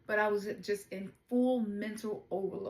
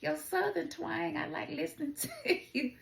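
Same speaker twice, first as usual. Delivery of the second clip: higher, fast gushing mock admirer with a laugh; imitating admirers; 7 semitones higher than usual. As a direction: play a charmed fan who laughs mid-line. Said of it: "Some people be like".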